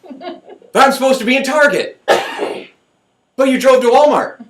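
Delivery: high, loud exasperated back-and-forth; mock quarrel. Mocking someone furious in Walmart instead of Target, high and exasperated. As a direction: play both sides of a silly argument.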